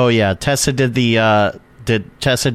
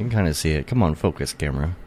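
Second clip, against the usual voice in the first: softer, lower aside; muttering; 7 semitones lower, 6 dB quieter, faster than usual. Real, not acted. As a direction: mumble it to yourself. Muttering to the camera.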